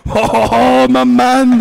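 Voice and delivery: loud, high, drawn-out cheer; big whooping shout of approval; Paddy McGuinness. Retold or imitated. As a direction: holler it like a delighted TV host. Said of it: "Paddy went".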